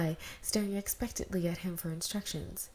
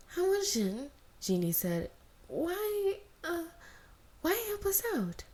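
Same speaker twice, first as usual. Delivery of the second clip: put-on much higher, light girl's voice; 11 semitones higher than usual. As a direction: ask with a small, curious girl's voice. Sister questions Wan Ji.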